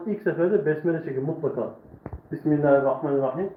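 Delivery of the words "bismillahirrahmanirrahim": recitation. Real, not acted. Reciting besmele before lesson.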